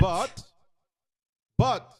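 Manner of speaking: "But" punched high and loud; stress on BUT. Shown as a demonstration. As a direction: hit each "But" hard, pitched well up. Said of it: "stress on the first word".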